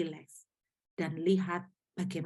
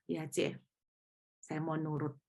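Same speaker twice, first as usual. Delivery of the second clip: meek surrender; 4 semitones lower, 8 dB quieter than usual. Retold or imitated. Student finally gives in.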